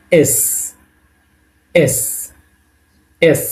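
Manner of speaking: "এস" with a dental s; "এস" ending in a clear dental hiss; letter-name demo. Shown as a demonstration. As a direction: say "এস", holding the s like Bengali দন্ত স. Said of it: "উচ্চারণ হবে দন্ত স এর মতো".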